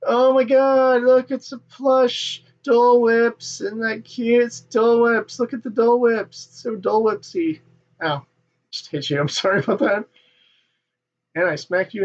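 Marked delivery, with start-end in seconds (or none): 0.1-7.6 mock gushing. Mocking gushing merch buyers.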